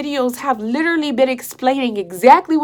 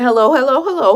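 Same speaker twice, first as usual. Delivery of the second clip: louder, testing hellos into a dead headset; imitated calling out; 5 dB louder than usual. Fiancé testing his broken headphones, calling out. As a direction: call out to see if anyone hears.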